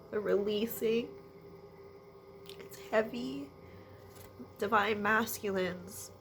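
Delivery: ordinary delivery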